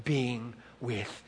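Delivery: very slow, low, hushed tenderness; God's tender response. Acted. God answering the praying heart, tender and hushed. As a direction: let each phrase rest softly, intimately. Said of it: "responds in the same way".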